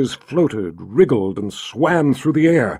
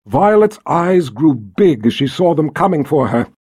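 plain reading voice

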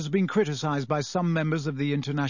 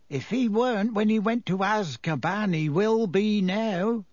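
higher, slow-talking driver character; Ern's slow voice; 6 semitones higher than usual. A narrator's reading. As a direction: take it slowly, in character. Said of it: "in his slow voice".

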